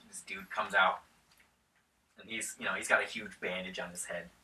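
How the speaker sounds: ordinary delivery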